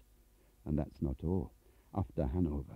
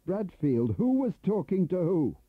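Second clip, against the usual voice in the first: higher and a bit louder, demanding; raised voice; 11 semitones higher, 4 dB louder than usual. Acted. Turner confronting Bradfield, voice rising too loud. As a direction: push him for an answer, too loud. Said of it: "Lower your voice".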